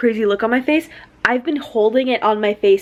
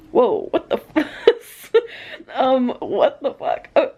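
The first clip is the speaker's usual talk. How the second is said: laughing disbelief; 3 semitones higher, slower than usual. Real, not acted. Laughing shock at the book.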